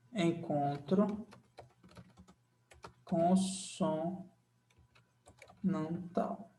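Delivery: Encontro consonantal dragged out very slowly and soft while writing; dictating slowly. Shown as a demonstration. Teacher writing the summary, saying each word very slowly. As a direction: stretch consonantal out as you write it.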